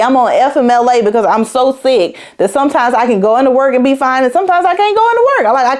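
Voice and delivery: high-pitched, insistent retelling of an argument; heated protest. Retold or imitated. Retold argument with medical staff.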